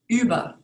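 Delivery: über with a rounded front ü; German über. Shown as a demonstration. Teacher modeling the ü umlaut. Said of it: "is pronounced differently".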